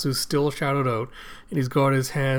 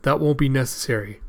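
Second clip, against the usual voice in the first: plain reading voice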